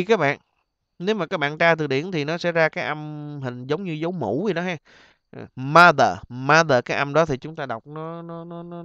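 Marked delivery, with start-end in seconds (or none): 5.6-6.8 "mother" with the stressed vowel blended between ớ and á. Shown as a demonstration. Teacher modelling the mũ sound for students, vowel between ớ and á. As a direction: say "mother" loud and clear, first vowel an open uh. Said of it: "kết hợp giữa âm ớ và âm á".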